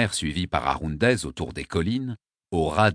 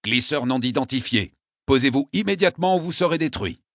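radio command; 6 semitones higher than usual. Acted.

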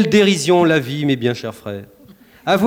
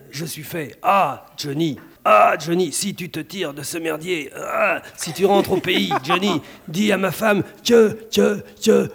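gasping war movie soldier, gunfire noises; dying soldier; slower than usual. Acted. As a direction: die dramatically in a war film. Dying soldier in war film parody.

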